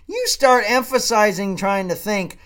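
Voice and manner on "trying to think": ordinary talk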